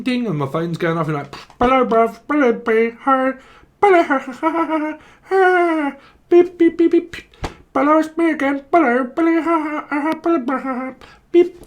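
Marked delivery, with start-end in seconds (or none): 1.6-11.6 very high, loud squawking phone voice; frantic high squeaks and beeps; garbled caller. Acted. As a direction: babble like a broken-up phone line.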